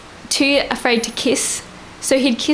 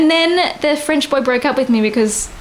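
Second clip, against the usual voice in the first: ordinary delivery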